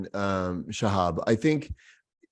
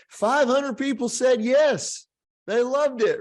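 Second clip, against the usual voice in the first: higher, slowly proud boast to the boss; proud report; 11 semitones higher, slower than usual. Retold or imitated. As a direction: triumphantly report the numbers. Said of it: "I say".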